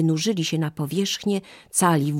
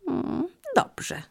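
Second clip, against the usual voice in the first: higher, slow, musing monkey voice; hesitant monkey; 14 semitones higher than usual. Acted. Monkey tempted by the fruit, high and hesitant. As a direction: think it over aloud, up high and unhurried.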